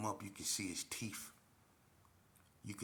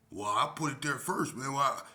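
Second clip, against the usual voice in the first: mock protest; 5 semitones higher, 9 dB louder than usual. Retold quarrel.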